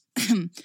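deliberate little cough to correct oneself; pointed throat-clearing. A narrator's reading. Narrator correcting kidnapped to puppet-napped, pointed throat-clearing.

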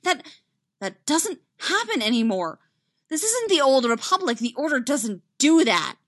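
high, loud, emphatic denial; protesting. A narrator's reading. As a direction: protest vehemently. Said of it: "Ahsoka protested".

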